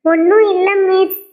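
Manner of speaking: high, loud tearful denial. Acted. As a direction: say it through put-on crying. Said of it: "ஏன் இப்படி அழுதுட்டு இருக்க".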